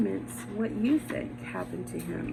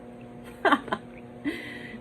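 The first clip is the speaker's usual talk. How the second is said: stifled villain's chuckle; breathy gloating chuckle; 6 semitones higher than usual. Acted. Narrator gloats over hidden crime.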